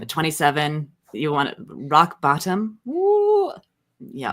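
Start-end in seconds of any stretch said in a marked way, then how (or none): 2.8-3.5 high wry whoop; a short sarcastic cheer; ironic high woo. Real, not acted. Ironic cheer about rock bottom.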